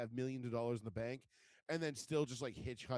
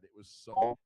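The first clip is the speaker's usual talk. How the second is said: ordinary talk, nothing marked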